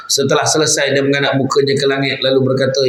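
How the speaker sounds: ordinary talk, nothing marked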